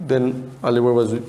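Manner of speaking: ordinary talk, nothing marked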